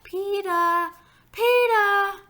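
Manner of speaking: put-on very high, loud little-kid call; drawn-out small-child holler, dropping the final R; toddler calling. A narrator's reading. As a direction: call out "Peter" as "Pee-tah". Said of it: "That's how he says my name".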